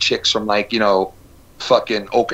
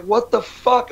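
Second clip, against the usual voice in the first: shocked; 11 semitones higher, slower than usual. Retold or imitated. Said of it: "And I'd be like".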